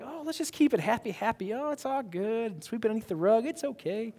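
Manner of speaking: smooth it all over in a high, airy singsong; mock happy-go-lucky. Extrovert keeping it all happy, mock placating. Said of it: "If you're an extrovert like me".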